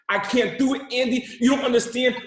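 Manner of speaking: high, loud mock wail; screaming tantrum. Kid crying to Andy over seatbelt, mock tantrum. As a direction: imitate a child throwing a fit. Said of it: "starts crying, kicking and screaming".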